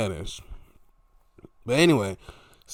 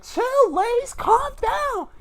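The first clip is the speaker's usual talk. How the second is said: high falsetto plea for calm; hammed-up pleading; mock peacemaker; 5 dB louder, slower than usual. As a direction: break up the squabble in a squeaky put-on voice. Acted peacemaker in ring argument.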